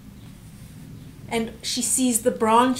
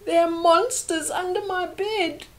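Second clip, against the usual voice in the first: put-on higher little-girl voice; frightened child again; 8 semitones higher than usual. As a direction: repeat the child's scared line. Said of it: "And here she's saying".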